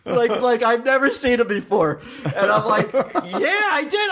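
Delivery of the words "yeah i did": laughing reply. Retold or imitated. Retelling his reply to Mike.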